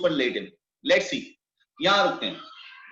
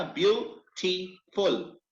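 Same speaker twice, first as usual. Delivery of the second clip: "Beautiful" slowly in three parts, pauses between; syllable by syllable; much slower than usual. Shown as a demonstration. Teacher giving students another example, syllable by syllable. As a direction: break "beautiful" into detached slow beats. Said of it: "इसी तरह".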